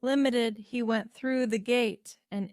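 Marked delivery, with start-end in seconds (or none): none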